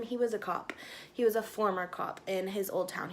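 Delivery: normal talking voice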